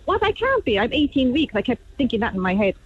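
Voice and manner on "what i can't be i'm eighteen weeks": high, stunned disbelief. Retold or imitated. Mother retelling her shock at the miscarriage news. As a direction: refuse to believe it, pitch jumping up. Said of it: "I was like".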